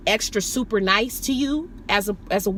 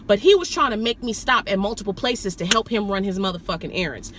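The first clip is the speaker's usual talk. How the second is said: normal talking voice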